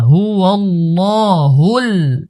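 normal talking voice

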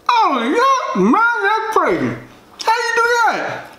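high, drawn-out falsetto amazement; hammed-up squeaky phone friend, hugely impressed; amazed caller. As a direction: play up the mock wonder. Acted caller reacting to burger.

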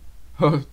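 light laugh at own pun; short chuckle. Real, not acted. Narrator chuckles at the Twilight pun. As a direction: chuckle at your own joke.

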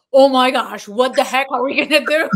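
hammed-up studio panic; mock panic. Team panicking over an impossible car crash, mock panic.

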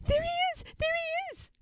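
very high, excited bird cries; squeaky little storybook birds; crying sparrows. A narrator's reading. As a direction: chirp out a sighting. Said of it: "cry all the little sparrows together".

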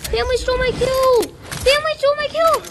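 very high, loud protest; real furious whine; outraged yell. Real, not acted. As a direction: shout the accusation at the room. Complaining about stolen kill.

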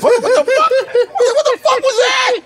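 very high, laughing disbelief; mock astonishment. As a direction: squeal it in exaggerated shock, half laughing. Mock shock at actor's real accent.

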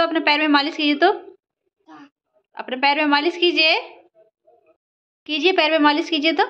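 put-on high teasing coo at a sulky toddler; mother teasing sulking daughter, high baby talk. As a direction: coax and tease in a high lilt.

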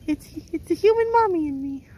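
high, excited pet's voice; playful voice of a dog pointing something out; voicing the dog. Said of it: "He says".

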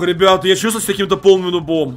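normal talking voice

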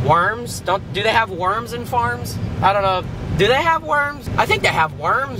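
ordinary talk, nothing marked

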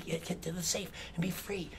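ordinary delivery

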